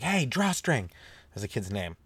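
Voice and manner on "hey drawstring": mom yelling. Retold or imitated. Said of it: "She's like".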